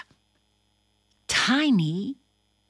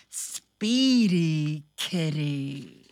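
slow drawn-out reading; storybook reading; 6 semitones lower, much slower than usual. Acted. Host reading the picture book page, slow. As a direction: read it slow for the kids.

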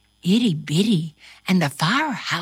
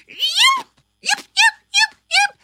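dog yips; 4 dB louder than usual. A narrator's reading. Dog yipping while running away.

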